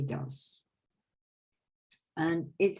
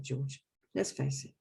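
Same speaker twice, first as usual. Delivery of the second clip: usual speaking voice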